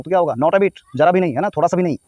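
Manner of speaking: ordinary talk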